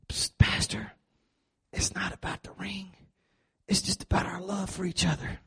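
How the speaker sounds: soft, far whispered plea. Retold or imitated. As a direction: murmur it secretly to the pastor. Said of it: "groom-to-be said this".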